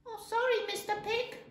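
high, stammered apology; apologetic little kid goat. A narrator's reading. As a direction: back off as a small goat.

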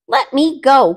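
loud high plea; cried. Acted. Rabbit stuck in glue, crying out loud. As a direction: cry out, stuck and struggling. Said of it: "cried Brer Rabbit".

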